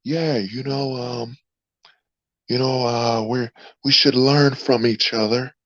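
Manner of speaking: mocking a meek soft-spoken guy; soft non-threatening voice. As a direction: talk like you'd never hurt anyone. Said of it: "I should be talking like".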